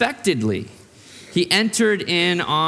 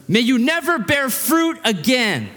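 pitched up, a solemn curse; Jesus cursing the tree; 8 semitones higher than usual. Retold or imitated. Pastor voicing Jesus cursing the barren fig tree, solemn and pitched up. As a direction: pronounce it like a stern prophet's curse.

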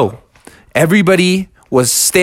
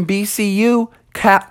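plain reading voice

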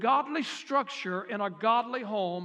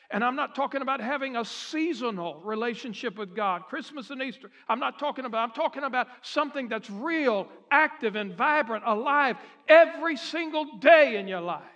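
swelling loud on "alive every single day"; preaching, building; 2 semitones higher, 4 dB louder than usual. Acted. Preacher urging the men to live faith, rising loud. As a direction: drive it home to the crowd.

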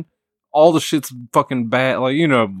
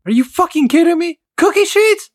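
much higher, louder furious yell; mom's furious shriek; 5 dB louder than usual. Retold or imitated. A fed-up mom screaming at her sister. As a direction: shriek it in disbelief. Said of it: "hearing my mom one morning just like".

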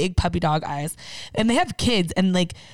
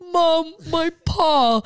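slow, much higher whimper for the hurt dog; whiny dog voice; 9 semitones higher, 3 dB louder, much slower than usual. Pretend. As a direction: voice the dog as a drama queen. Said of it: "she's like".

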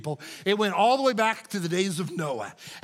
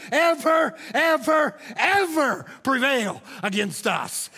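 rising, louder, rhythmic sermon cadence building to shouts; preaching at full force; 4 semitones higher, 3 dB louder than usual. Acted. Pastor reads the church's letter to Satan, full-force preaching. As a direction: thunder the church's defiance at Satan.

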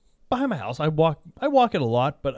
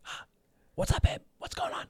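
whisper; 6 dB quieter than usual. Retold or imitated. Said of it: "Why are you whispering?".

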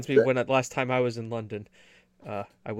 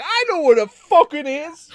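high, loud cartoon British retort; indignant, overdone English accent; bad British accent; 12 semitones higher, 8 dB louder than usual. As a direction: shout back in fake British. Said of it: "an American's attempt at a British accent".

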